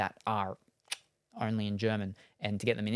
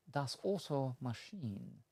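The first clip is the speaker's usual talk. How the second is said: drawn out, softer German try; slow careful German; 8 dB quieter, slower than usual. Shown as a demonstration. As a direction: sound out the German slowly. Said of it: "pretty sure it's Autowagen or simply Auto".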